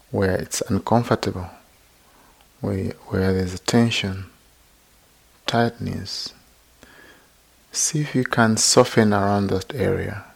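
unhurried, even-toned meditation teacher; guided meditation. Real, not acted. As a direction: gently guide listeners into body and breath, leaving long silences.

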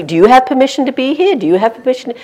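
mock officious demand; mocking challenge. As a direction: fire the question back at them through the megaphone. Megaphone man mocking security guards.

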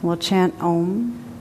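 usual speaking voice

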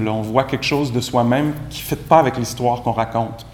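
ordinary talk, nothing marked

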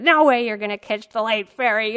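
loud retold yell. Local man yelling at her about the ferry, loud. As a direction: bark it like the annoyed local. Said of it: "yelling at me".

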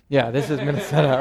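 fast mock-sure line with a laugh in it; laughing mock quote. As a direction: tease the idea while laughing. Mock certainty, laughing through it.

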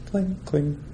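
high, soft "cling, cling"; imitated ringing sound. Teacher illustrating sensitivity to sound, imitated ringing. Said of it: "to sound".

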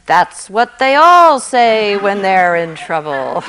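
loud, amused booming voice; booming voice of God. A narrator's reading. God answers the atheist, booming and amused. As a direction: boom it across the canyon with a chuckle. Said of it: "a mighty voice boom".

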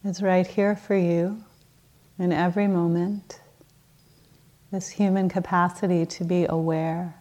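unhurried meditation guidance with long pauses; guided meditation. Acted. Teacher settles the group into morning practice, calm guided-meditation delivery. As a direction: ease them gently into stillness, leaving space between phrases.

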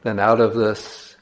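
slow, measured meditation voice with long pauses; guided meditation. Acted. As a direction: guide listeners slowly, leaving space between phrases.

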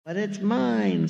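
high, drawn-out storybook protest; mock scream. A narrator's reading. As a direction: wail it possessively as an indignant bird. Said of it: "screamed the bird".

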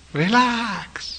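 put-on a big beaming Thai-accented relax. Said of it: "say it with a kind of Thai accent and a big beaming face".

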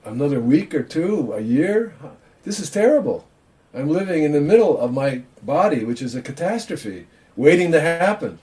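a touch of mock alarm; mock despairing old man. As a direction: ham up the worried thoughts. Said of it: "they should be thinking".

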